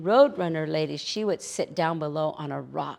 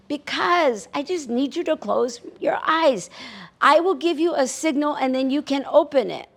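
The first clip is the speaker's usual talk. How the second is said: high, loud calling down; 8 semitones higher, 8 dB louder than usual. Acted. Coyote shouting down from the hilltop to Roadrunner. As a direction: call it across the distance.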